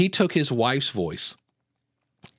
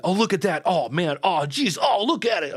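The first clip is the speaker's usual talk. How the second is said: mock amazed podcaster reacting to a video; higher-pitched, hammed-up wonder in short bursts; Joe Rogan; 5 semitones higher than usual. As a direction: gawp aloud at something listeners can't see. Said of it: "Joe Rogan of them".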